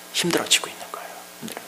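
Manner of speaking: whisper. Acted. Sorrowful aside.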